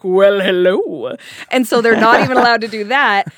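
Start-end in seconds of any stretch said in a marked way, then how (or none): 0.0-1.2 men in Rio flirting with a missionary; mock flirty. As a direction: stretch it out like a smooth talker. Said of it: "they're like".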